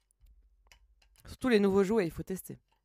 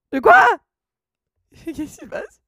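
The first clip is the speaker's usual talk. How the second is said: high, loud startled yelp; 7 semitones higher, 8 dB louder than usual. Real, not acted. Streamer to chat as the emotes glitch, startled. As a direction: blurt it out in sudden disbelief.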